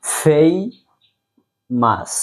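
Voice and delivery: ফেমাস drawn out very slowly as fay-mas; slow model pronunciation. Shown as a demonstration. Teacher asks the class to repeat, very slow. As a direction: stretch ফেমাস so students can copy it. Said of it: "আমার সাথে বলেন".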